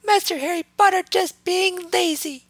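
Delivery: very high reproachful elf voice; scolding house elf. A narrator's reading. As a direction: scold him like a tiny butler. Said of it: "Dobby asserted with crossed arms".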